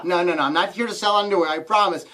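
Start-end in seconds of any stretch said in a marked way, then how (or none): none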